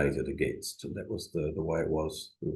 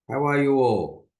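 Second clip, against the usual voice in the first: cheerful greeting; 7 semitones higher, 5 dB louder than usual. Real, not acted.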